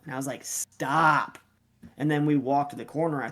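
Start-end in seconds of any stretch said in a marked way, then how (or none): 0.4-1.4 one long, drawn-out plea; retold exasperated command. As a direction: imitate a fed-up coach cutting off an argument. Coach retells telling dad off.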